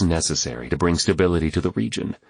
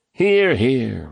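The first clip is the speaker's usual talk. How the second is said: ordinary delivery, nothing marked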